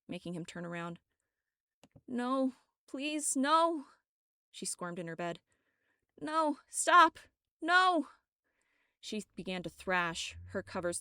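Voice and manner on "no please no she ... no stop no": pleading cries climbing higher and louder; rising nightmare pleas; growing panic. Acted. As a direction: beg louder and higher with each no. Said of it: "Her voice was getting louder".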